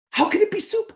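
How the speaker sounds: high, disbelieving outburst; incredulous. Retold or imitated. Husband hearing dinner is soup, squeaking in disbelief. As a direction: pitch leaps up in shock.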